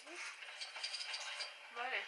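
ordinary delivery

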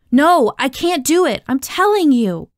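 high, loud, desperate refusal; insistent protest. Acted. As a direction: plead loudly that you mean it. Pleading with director to be excused.

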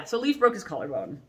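ordinary talk, nothing marked

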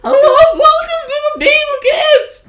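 very high, very loud cartoonish host; Billy accent. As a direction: blast out a silly squeaky show welcome. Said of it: "I'll do a Billy accent".

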